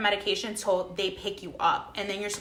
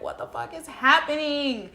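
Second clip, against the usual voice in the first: higher-pitched, confused mock outcry; retold bewilderment; 6 semitones higher, 3 dB louder than usual. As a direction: replay waking up totally lost. Groggy bewilderment on waking, retold.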